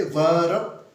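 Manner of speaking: वर्ब split as va-rab; wrong two-syllable verb. Shown as a demonstration. Teacher showing what we cannot say, verb in two syllables. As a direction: add a vowel inside वर्ब, va-rab. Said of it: "हम ये नहीं कह सकते".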